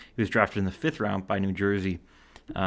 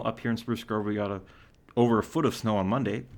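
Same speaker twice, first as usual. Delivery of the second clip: normal talking voice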